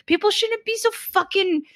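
high, fast, put-upon voice; high mock whine about having to find balance; mocking whiny complaint. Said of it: "I'm like".

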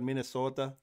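Minnesota with long northern O, Canadian-style; mild mock Canadian accent. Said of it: "That, that Canadian accent".

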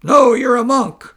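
wife shooting back loudly at her husband; angry retort. Acted. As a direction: fire it straight back at him. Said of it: "shot back Wonderful Flower".

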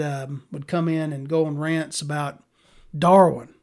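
ordinary talk

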